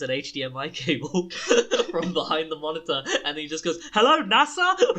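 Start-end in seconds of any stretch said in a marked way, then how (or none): 3.9-5.0 much higher, louder, slow drawn-out greeting; mock hacker call. Friend unplugging a cable pretending to phone NASA, hammed-up. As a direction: stretch it like a cartoon villain answering.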